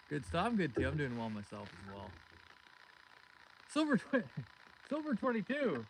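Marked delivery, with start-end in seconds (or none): 3.7-5.9 high-pitched excited shout-out with a laugh; gleeful, high name call; delighted greeting. Real, not acted. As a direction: break off laughing, then hail the name. Greets returning viewer, breaking into laugh.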